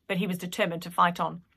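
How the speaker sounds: plain reading voice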